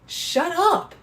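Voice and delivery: high, playful "shut up" to the inner voice; snapping back. Acted. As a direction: cut the voice off, half joking. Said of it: "just be like".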